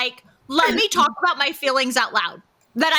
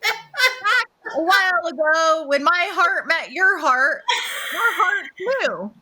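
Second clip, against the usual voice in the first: slow, hammed-up sappy vow reading; mock vows read off a phone; much slower than usual. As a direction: ham up a corny love vow, slowly. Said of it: "Do I put them on my phone and go like".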